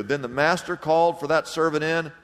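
usual speaking voice

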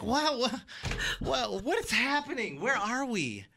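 mock bewildered, where am I; bewildered disbelief. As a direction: sound lost in a surreal moment. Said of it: "I'm like".

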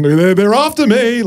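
high, drawn-out mock cry for help; panicked movie hero. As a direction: play a hammed-up chase-scene panic. Acting Brendan Fraser fleeing monsters in trailer.

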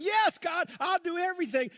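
high, loud cry of devotion; passionate vow. Acted. Believer laying it on the line to God, passionate shout. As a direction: fling out the pledge at full voice. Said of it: "moments of passion".

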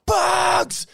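high shouted greeting across the street; calling out. Retold or imitated. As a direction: yell his name over the traffic. Tooting horn, calling across road.

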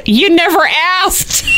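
laughing punchline. Retold or imitated. Sharon's punchline.

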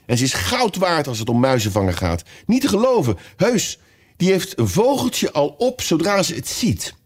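high-pitched delighted enthusiasm; eager, with pleasure. A narrator's reading. Alice gladly tells about her favourite pet, delighted. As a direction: gush happily, rising high at the boasts. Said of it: "Alice ging er met plezier op in".

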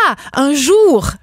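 drawn-out put-on French realization; mock realization. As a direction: imitate someone finally getting it. Acts French person finally understanding.

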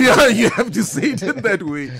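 laughing through the words, high and loud; cracking up while insisting on it. Real, not acted. Guest laughing at the Transvaal accent joke, laughing.

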